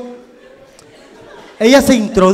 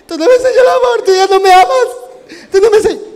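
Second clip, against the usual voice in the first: higher, whiny fake sobbing plea; mock crying; 10 semitones higher, 3 dB louder than usual. Comedian imitating himself crying to the woman, higher whiny mock sob. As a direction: whimper it much higher like a hurt lover. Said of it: "Comencé a llorar".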